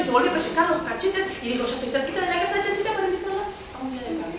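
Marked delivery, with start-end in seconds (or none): 1.6-3.4 higher, fast little pig voice; excited piglet. Acted. Chanchito on his way to Chanchita, fast and eager.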